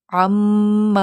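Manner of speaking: "ثم" with the doubled میم hummed long, lips closed; held nasal ghunna. Shown as a demonstration. Teacher demonstrating doubled meem, long nasal hum. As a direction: close on the m of "ثم" and let it ring in the nose. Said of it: "میم کی sound دیکھیں کس طرح آ رہی ہے".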